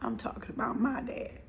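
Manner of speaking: real choked-up near whisper; soft, voice giving out with emotion. Real, not acted. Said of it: "Excuse me for getting choked up".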